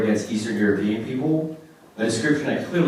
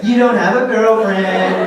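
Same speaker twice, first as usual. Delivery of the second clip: slow, higher, louder taunt; mock audience jab; 10 semitones higher, 10 dB louder, much slower than usual. Echoing the crowd's laugh at him, slow loud mock jab. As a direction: drag it out mocking him. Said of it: "I don't know how to take that".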